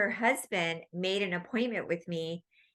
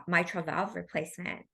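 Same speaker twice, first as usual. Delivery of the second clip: ordinary talk, nothing marked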